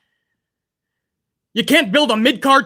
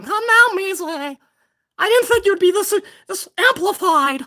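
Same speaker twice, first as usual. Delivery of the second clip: much higher whiny mock of a chatter; 7 semitones higher than usual. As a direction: parrot the scolding viewer. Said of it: "People telling me to calm down".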